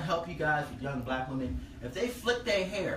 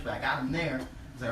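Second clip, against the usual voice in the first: ordinary delivery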